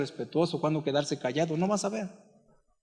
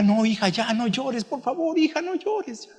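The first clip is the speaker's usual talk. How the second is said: mother pleading, climbing much higher as she goes; 6 semitones higher than usual. Retold or imitated. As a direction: beg your daughter to stop crying, rising higher and higher. Mother begs crying daughter.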